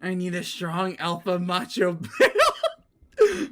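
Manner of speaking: slow, giggly read; a read dissolving into giggles; laughing while reading. Real, not acted. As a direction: fight back laughter on the line. Reading absurd character line.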